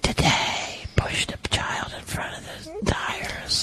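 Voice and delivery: slow, soft demon whisper; slow eerie devil whisper; scary demon voice. Pretend.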